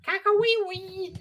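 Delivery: high, slow crowing cry; silly high voice. Acted. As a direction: crow it high and drawn out. Repeating silly catchphrase.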